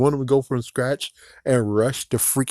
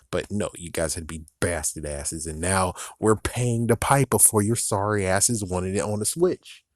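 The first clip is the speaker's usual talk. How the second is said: normal talking voice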